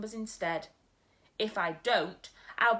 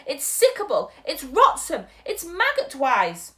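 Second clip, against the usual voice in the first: much louder, higher disgusted giant; 6 semitones higher, 9 dB louder than usual. Acted. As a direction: relish the awful taste loudly.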